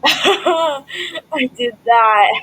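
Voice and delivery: laughing. Real, not acted. Proud laughing replay of own move.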